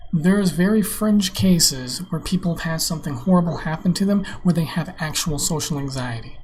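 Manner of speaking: ordinary delivery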